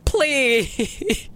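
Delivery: high, desperate pleading; very distressed. Acted. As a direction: beg a dying brother to keep going. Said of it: "said in a very distressed tone".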